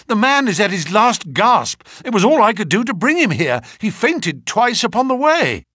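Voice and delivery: high, urgent, pressing report; acted breathless alarm; agitated cry. A narrator's reading. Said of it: "he cried".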